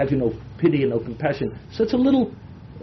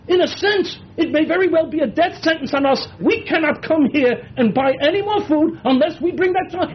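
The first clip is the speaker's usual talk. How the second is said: exploding in anger; 11 semitones higher than usual. Acted. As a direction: hurl it at him like Yehuda losing his temper. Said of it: "I would have exploded in a paroxysm of anger".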